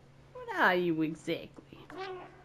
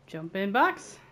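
bright loud call with a rising end; louder playful call; 7 dB louder, slower than usual. Pretend. Playing a game, calling the order out louder. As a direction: announce it like a silly order.

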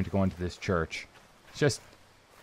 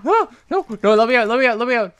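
high, loud frantic pleading; rapid high cries of alarm; panicked yelling; 11 semitones higher, 6 dB louder, faster than usual. Real, not acted. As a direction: beg to get out in a panic. Panics, trapped by game monster.

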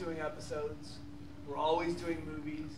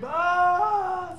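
high strained warm-up scream; demo scream; 12 semitones higher, 12 dB louder than usual. Shown as a demonstration. Showing how he screamed himself hoarse before auditions. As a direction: scream to wreck the voice. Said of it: "I would scream myself hoarse".

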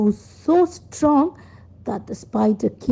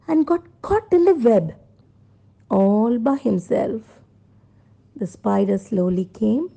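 plain reading voice

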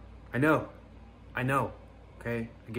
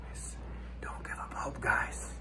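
softer, hushed whisper; hushed, urgent whisper; 7 dB quieter than usual. Acted. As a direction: whisper as if still hiding. Whispering after fleeing.

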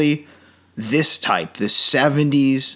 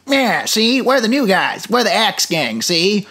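film noir gangster; 6 semitones higher than usual. Retold or imitated. Mocking the dubbed gang as film noir gangsters. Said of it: "film noir voicing".

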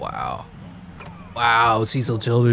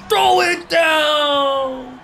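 much higher, very slow yelled command; hype shout; 12 semitones higher, much slower than usual. Real, not acted. Streamer yelling at his player to dunk it. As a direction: stretch it out, urging the dunk.

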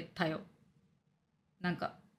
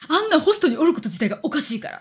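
higher, louder indignant retort; retold angry scolding; 6 semitones higher, 5 dB louder than usual. Friend objecting to that host being there, angrily. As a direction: imitate a friend laying down the law. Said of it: "のんちゃんにマジで怒られた".

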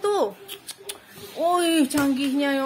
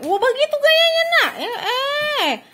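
excited cheer; 9 semitones higher than usual. Real, not acted. Cheering child's dance moves.

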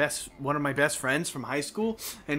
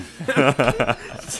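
loud laugh; 3 dB louder than usual. Real, not acted. Laughing after the story.

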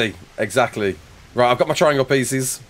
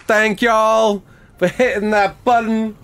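slow, higher, hammed-up American drawl; American accent; 6 semitones higher, slower than usual. Shown as a demonstration. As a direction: put on an exaggerated Southern American accent. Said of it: "for an American accent".

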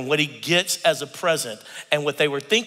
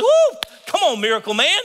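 hype cheer; 12 semitones higher, 7 dB louder than usual. Retold or imitated. Cheering up father-in-law.

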